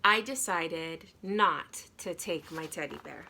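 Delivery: ordinary delivery, nothing marked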